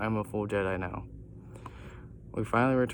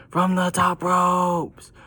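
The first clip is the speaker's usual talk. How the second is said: high, drawn-out full-force shout; long, loud, high yell; wrestler's scream; 9 semitones higher, 10 dB louder, much slower than usual. A narrator's reading. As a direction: holler it out like a wrestling battle cry. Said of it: "Then The Rock screams".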